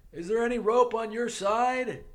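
put-on a shy boy calling across the gym; high boyish voice. Bobby voiced in story.

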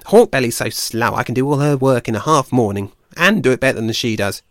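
a fed-up forager venting; irritable squirrel. Acted. Ashford complaining to Blue Tongue about the badger, fed-up voice.

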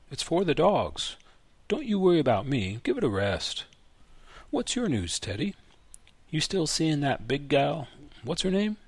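put-on old man changing the subject with a prod; old grandfather. Grandfather voiced in story.

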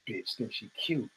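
catty woman's look. Retold or imitated. Women sizing up an outfit in the club, low catty sneer. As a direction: sneer it low and quiet like a judging clubgoer.

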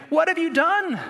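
high, loud frightened outcry; terrified sailors shouting at Jonah; horrified cry. Acted.

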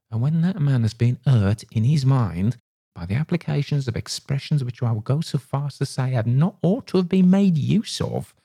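working himself up in offended pride; rising heated landlord. A narrator's reading. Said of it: "His voice was rising all this time".

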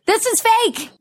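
high-pitched exasperated insistence; indignant outburst. Real, not acted. Host ranting about fake scarcity, indignant.

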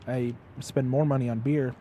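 ordinary delivery

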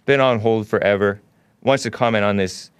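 ordinary delivery

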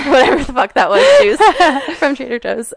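laughing while talking. Real, not acted. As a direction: giggle through it. Laughs at own juice.

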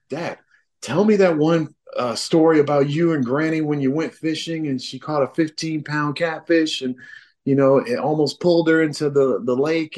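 mock child begging dad for the fishing tale; imitating his kids.

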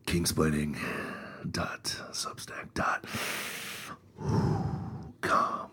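low breathy sexy whisper. Shown as a demonstration. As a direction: purr it out seductively. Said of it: "Say that a little bit sexier".

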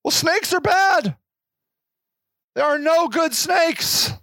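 hammed-up scared interns insisting snakes are bad; mock squeaky panic. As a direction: whine it like a frightened child. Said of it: "You're like".

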